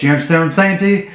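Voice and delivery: ordinary delivery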